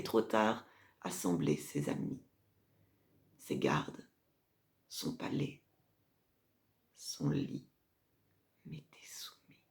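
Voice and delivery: sinking into a low near-whisper, broken by pauses; hushed, ominous. Acted. Mother recounts seizing the dying emperor's household, hushed and ominous.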